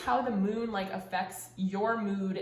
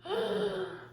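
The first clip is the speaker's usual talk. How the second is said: sharp high gasp of being moved; a choked emotional gasp; tearful gasp; 11 semitones higher than usual. Real, not acted. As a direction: catch your breath on the edge of tears. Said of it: "Oh my God, I'm gonna cry".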